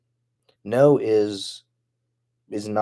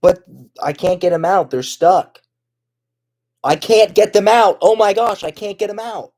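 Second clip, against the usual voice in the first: mock panic; 9 semitones higher, 9 dB louder than usual. Panicking about bugs, rising.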